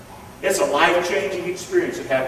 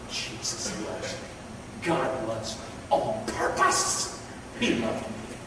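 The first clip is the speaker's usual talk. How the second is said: intimate whisper, much softer and lower; a soft, voiceless hush; 9 semitones lower, 10 dB quieter, slower than usual. Real, not acted. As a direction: breathe it like a secret to the congregation. Whispered personal confession.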